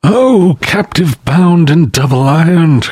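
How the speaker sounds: high wailing ghost voice; ghost crying out. A narrator's reading. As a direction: wail like a chained ghost. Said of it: "cried the phantom".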